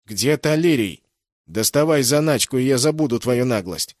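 loud, high furious shout; angry demand. Acted. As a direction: beat the answer out of him. Guard kicks and demands crystal.

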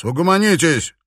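angry shouted ultimatum; barked warning. A narrator's reading. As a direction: order the brawlers to settle down. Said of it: "рявкнул стоящий у двери Юлиус".

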